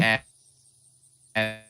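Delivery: robotic broken connection; choppy robotic bad line. Real, not acted. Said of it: "you're going very robotic, [name]".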